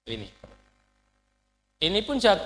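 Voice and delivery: ordinary talk, nothing marked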